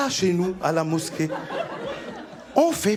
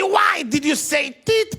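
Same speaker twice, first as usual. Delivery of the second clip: angry interrogator; 5 semitones higher, slower than usual. Retold or imitated. Interrogator shouts.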